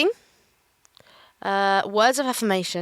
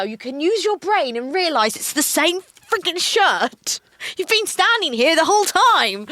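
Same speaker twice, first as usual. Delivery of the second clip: indignant rant; 5 semitones higher than usual. Retold or imitated. Customer retells arguing with shop worker, indignant. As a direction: argue back, drawing out the key words.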